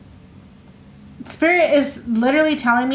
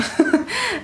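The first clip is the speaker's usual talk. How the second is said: short laugh; 11 semitones higher than usual. Real, not acted. Laughs at own rambling.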